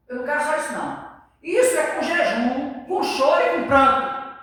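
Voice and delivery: loud, high fiery preaching; full-force preaching. Acted. Preacher urging the congregation to return with weeping, loud and high. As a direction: thunder it from the pulpit.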